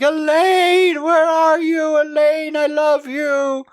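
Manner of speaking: high, loud mock wail; sobbing and screaming. Wayne crying out for missing Elaine, mock sobbing scream. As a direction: imitate a man wailing desperately, vowels drawn out. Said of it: "Sobbing and screaming".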